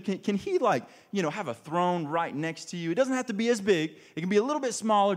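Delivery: pushy mom. Retold or imitated. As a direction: play the overeager mother pleading for her sons. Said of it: "then she's like".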